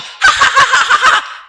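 loud high burst of laughter. Real, not acted. Preacher laughing at his own joke, loud high laugh. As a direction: let out a big high laugh.

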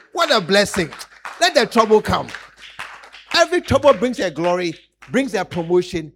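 ordinary talk, nothing marked

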